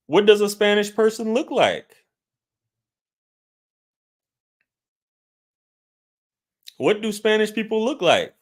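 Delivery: very high-pitched disbelief; incredulous demand. Real, not acted. Challenging a viewer who said they look Spanish, incredulous.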